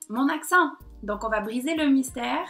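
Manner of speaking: ordinary delivery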